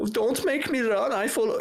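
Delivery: high-pitched German cartoon kid; Uter German accent. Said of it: "I had to get into the character".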